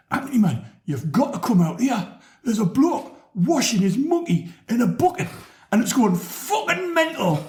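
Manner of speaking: high, excited Geordie voice; Geordie accent. Retold or imitated. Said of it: "I'm gonna do a Geordie accent".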